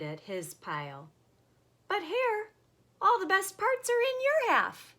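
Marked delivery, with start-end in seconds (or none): 1.9-4.9 complaining Bear. Acted.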